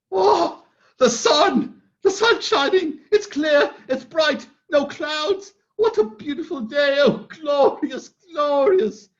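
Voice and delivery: high, bright exclamations of joy; ecstatic. Acted. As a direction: cry out at the sunshine. Scrooge sees the sun.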